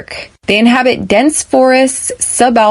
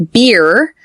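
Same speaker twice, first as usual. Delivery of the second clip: ordinary delivery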